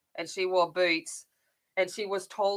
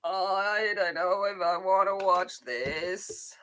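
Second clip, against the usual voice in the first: mock dread. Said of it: "And I'm like".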